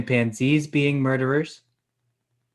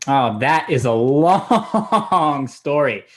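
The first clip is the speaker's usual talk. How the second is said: long drawn out on a laughing wobble; drawn-out emphasis; 7 dB louder, much slower than usual. Acted. Storyteller teases a long tale, drawn-out emphasis. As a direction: stretch 'long' out loud and slow. Hammed-up teasing build-up to tale.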